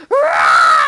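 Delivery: loud playful roar; monster roar. Acted. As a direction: roar at the viewers.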